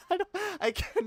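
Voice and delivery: breaking up laughing; giggling, giving up; laughing at his own attempt. Real, not acted. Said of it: "laughing at failed accent".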